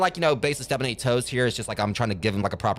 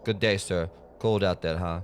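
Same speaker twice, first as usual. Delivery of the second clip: mock Jamaican accent; 5 semitones lower, 3 dB quieter than usual. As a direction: play the Jamaican pastor, half-failing. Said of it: "mock Jamaican accent".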